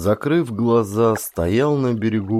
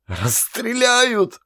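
much higher, slow terrified cry; panicked cry; 13 semitones higher, much slower than usual. A narrator's reading. Doomed soldier cries out in terror. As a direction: wail it in panic. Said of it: "И опять во всё горло".

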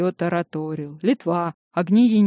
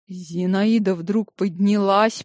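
ordinary delivery, nothing marked

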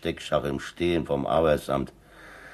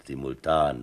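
ordinary delivery

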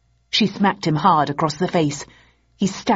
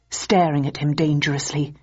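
plain reading voice

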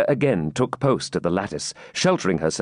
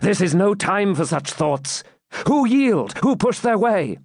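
raised, insistent questioning; urgent knight; 10 semitones higher than usual. Acted. Knight demands who is yielding, urgent. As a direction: cut through her dismay, pitched higher.

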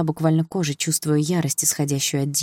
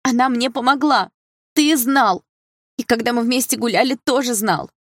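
high, loud, anguished accusation; angry outburst; 8 semitones higher, 4 dB louder than usual. A narrator's reading. Sister hits brother, accusing him, high angry shouting. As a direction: accuse him in a raised, high-pitched cry. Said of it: "била его по плечу сестра".

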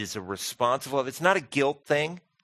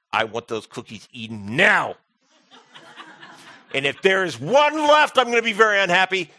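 mock stern dad; 7 semitones higher, 7 dB louder than usual. Dad teasing kids about cookies.

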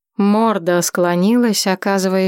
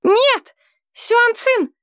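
very high desperate cry; desperate shout; much slower than usual. A narrator's reading. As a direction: scream the name into the sky. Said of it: "Вновь в воздух крикнула".

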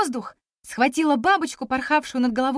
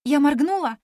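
plain reading voice

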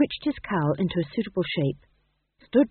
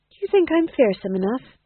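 anxious amateur actor; 7 semitones higher, 5 dB louder than usual. A narrator's reading. Nervous actor figure.

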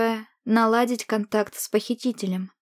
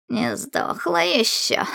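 creaky witch voice; 5 semitones higher, slower than usual. A narrator's reading. The witch speaks again.

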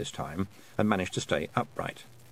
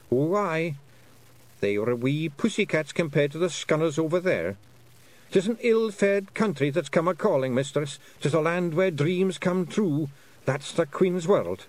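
ominous Scots pixie; 7 semitones higher than usual. Acted. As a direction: warn of danger like a little Feegle. Rob Anybody describes the other side.

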